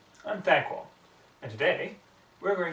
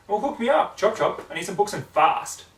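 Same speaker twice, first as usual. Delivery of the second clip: brash tomboy voice; 7 semitones higher, 6 dB louder than usual. Acted. Rainbow Dash demanding books.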